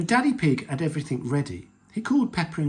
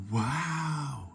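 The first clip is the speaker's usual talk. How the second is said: long, swooping "wow"; wide-open childlike amazement; awed gasp; 4 dB quieter than usual. A narrator's reading. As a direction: gasp in wonder, drawing it out. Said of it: "gasped Peppa and George".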